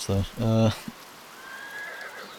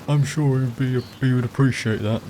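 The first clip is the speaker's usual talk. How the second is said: higher-pitched common fellow's voice; plebeian guy; 4 semitones higher than usual. Acted. Player auditioning a voice for the Baron's follower, higher plebeian. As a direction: try him as an ordinary commoner. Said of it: "like a plebeian guy".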